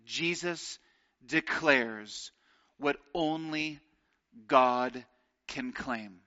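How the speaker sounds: slow, dropping, reverent quote read; slow solemn reading of a commentator's line. Acted. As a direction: let each word fall heavy and slow. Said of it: "one commentator, Edward Klink, says".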